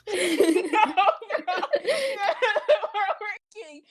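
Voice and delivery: squeaky laughing, slowed right down; wheezing laughter. Real, not acted. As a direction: gasp it out mid laugh. Laughing through the retelling.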